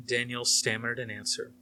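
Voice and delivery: ordinary delivery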